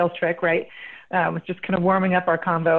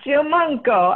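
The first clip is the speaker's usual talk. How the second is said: higher, amateur Italian accent on Giamanco; attempted Italian accent; 7 semitones higher, slower than usual. Shown as a demonstration. Host copying guest's name after correction, attempted Italian accent. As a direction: have a game go at Giamanco with an Italian lilt. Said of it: "I am terrible with the Italian accent".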